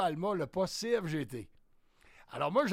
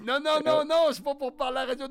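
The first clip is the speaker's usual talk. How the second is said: higher, louder panicked no; loud protest; 8 semitones higher, 7 dB louder than usual. Retold or imitated. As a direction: protest loudly, voice up high. Panicked refusal on the phone.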